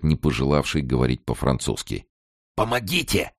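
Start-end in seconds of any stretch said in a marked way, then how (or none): 2.6-3.3 high, held-back croak; hoarse cry for help. A narrator's reading. Inmate waking cellmate for help, hoarse and urgent. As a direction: croak it out urgently, without full volume. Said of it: "прохрипел он".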